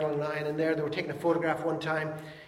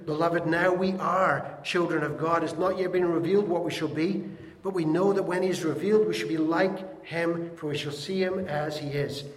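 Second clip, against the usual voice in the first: scripture reading; 2 semitones higher, 6 dB louder than usual. Acted. Preacher reading the verse to the congregation, solemn and loud. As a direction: read each phrase of the verse out to the room.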